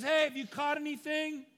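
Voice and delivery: high, loud yell across the water; calling out. Acted. Jesus yells out to fishermen in a boat, calling out. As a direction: holler it out to someone far off. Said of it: "Jesus kind of yells out there".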